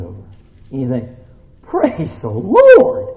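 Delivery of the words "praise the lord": drawn-out, high, loud praise; jubilant relief. Acted. Worker thanking God for a cloud, exultant relief. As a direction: stretch it into a hammed-up hallelujah cry.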